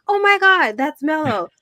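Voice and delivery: high, loud gush on spotting a friend; excited recognition. Retold or imitated. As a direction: re-enact a thrilled shout-out, pitch leaping. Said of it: "I'm like".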